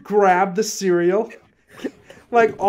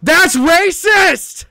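loud drawn-out high accusation; slow, high and loud, scandalized; mock outrage; 12 semitones higher, 8 dB louder, much slower than usual. Real, not acted. Accusing friend jokingly.

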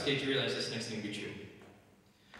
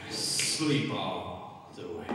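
hypnotist command; 4 semitones lower, 3 dB louder, much slower than usual. Acted. Hypnotist putting volunteers under.

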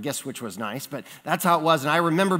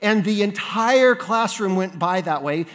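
normal talking voice

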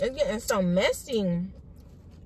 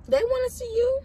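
mock high teasing; 13 semitones higher than usual. Teasing mom on camera.

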